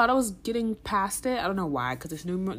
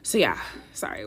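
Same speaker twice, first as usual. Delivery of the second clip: breathy stuffy-nosed apology; sniffly breathing through it; audible breathing; 8 semitones lower than usual. Real, not acted. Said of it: "I can't breathe any other way".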